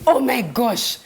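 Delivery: startled "oh my gosh" in bed; sudden realization. Retold or imitated. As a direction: blurt it as it dawns on you. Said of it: "sitting up in my bed and going".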